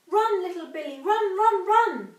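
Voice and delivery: high, loud cry urging him to run; frantic urging shout, pitch up; urgent shout. A narrator's reading. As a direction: yell it like a desperate warning. Urging Billy to flee.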